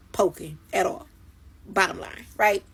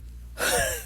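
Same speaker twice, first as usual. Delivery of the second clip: breathless silent laugh; 5 dB quieter than usual. Real, not acted. Laughing at the memory.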